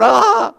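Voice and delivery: high, loud little yelp of panic; a quick hammed-up shriek of alarm; mock panicked cry. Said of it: "we start freaking out".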